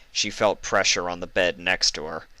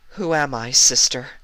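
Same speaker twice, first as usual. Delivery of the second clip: fragile question; 7 semitones higher, 5 dB louder than usual. Acted. Frightened Luna asks her sister.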